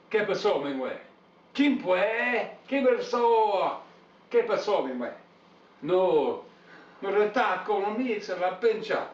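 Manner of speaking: high, heated mock Mexican accent; mock Latin accent. Asked for accents, rants in high mock Mexican Spanish. Puts on foreign accent on request.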